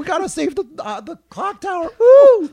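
very high mock pop-star voice ending in a whoop. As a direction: squeak out a terrible singer impression. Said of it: "I don't do a [name] impression".

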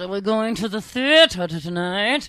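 a hint of an old lady; slow, loud old lady through clenched teeth. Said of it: "I was actually being an old lady".

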